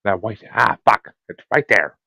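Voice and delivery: normal talking voice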